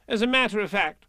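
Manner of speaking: high-pitched fussy rabbit; brisk Rabbit. Acted. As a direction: pipe up as a busy rabbit. Voices Rabbit.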